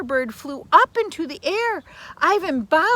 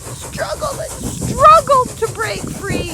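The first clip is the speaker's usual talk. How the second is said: higher, louder, urgent narration; dramatic; 12 semitones higher, 7 dB louder than usual. A narrator's reading. Narrating the firebird's frantic fight, high and urgent. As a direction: race through the struggle.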